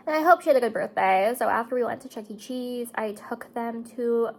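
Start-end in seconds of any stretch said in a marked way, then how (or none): none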